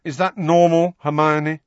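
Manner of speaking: high, loud irritable query. A narrator's reading. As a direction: throw it out as an irritated challenge. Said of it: "said Ron irritably".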